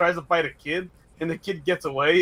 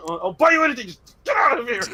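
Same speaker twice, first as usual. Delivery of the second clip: raised, desperate plea to leave; pleading shout; 5 semitones higher than usual. Pretend. Pleading with man at beach.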